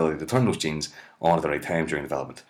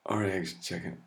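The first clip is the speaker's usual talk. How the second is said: ordinary talk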